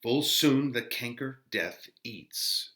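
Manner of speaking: slow, swelling Shakespeare reading with loud peaks; dramatic reading. Acted. Teacher reading Friar Lawrence's speech to the class, dramatic and slow. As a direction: declaim the friar's speech with feeling.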